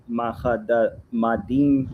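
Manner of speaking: "mahadamadim" haltingly sounded out, very slowly; butchered pronunciation. Real, not acted. Man reading a search result aloud, "mahadamadim" slow and stumbling. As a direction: stumble through "mahadamadim" piece by piece with throaty h. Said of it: "you're butchering it when you try".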